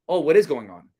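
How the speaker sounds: "what is going on" pushed downward as a challenge. Shown as a demonstration. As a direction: challenge them with a falling tone. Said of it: "down, a downward tone means you're challenging".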